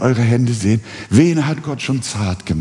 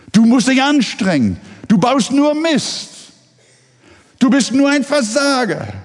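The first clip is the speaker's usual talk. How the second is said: much higher, mocking scold voice; high-pitched mocking nag of everyone who told him to improve; nagging scolders. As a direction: rattle off the endless reproaches of others in a shrill mock-nag. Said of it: "Man hat ihm immer gesagt".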